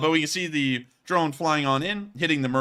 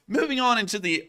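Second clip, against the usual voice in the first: voice crack; 9 semitones higher than usual. Real, not acted. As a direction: voice cracking high on the phrase. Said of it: "did you hear that voice crack".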